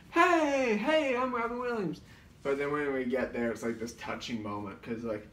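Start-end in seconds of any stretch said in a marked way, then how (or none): 0.1-2.0 higher, bouncy hammed-up celebrity greeting; hyper Robin Williams. Rambunctious impersonator running around, hyper high greeting. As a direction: bounce around announcing yourself. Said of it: "running all over".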